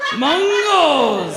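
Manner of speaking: loud playful yell of "Mungos"; mock shout. Comic yelling the heckle back at the crowd, mock shout. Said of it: "You shouted "Mungo" at me".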